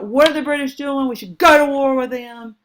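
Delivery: put-on high and loud, young hothead ready for war; hothead young congressman. Teacher voicing a hotheaded young congressman, loud and high. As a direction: play an angry young Warhawk demanding war. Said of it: "be like".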